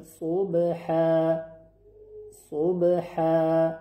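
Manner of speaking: صبحا with a full heavy sad and rounded lips; صبحا slow, sad thick and rounded. Shown as a demonstration.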